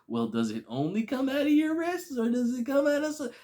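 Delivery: high, loud curious quote; imitated eager questioning. Imitating a Spider-Man quizzing another on webs, eager.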